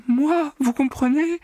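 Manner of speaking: very high, slow sobbing plea. A narrator's reading. Secretary sobbing her plea to the accountant. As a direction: plead through tears. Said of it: "sanglota la secrétaire".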